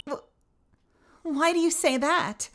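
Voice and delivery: put-on high, startled girl's voice; a timid witness caught off guard. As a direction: jump up into a nervous high voice.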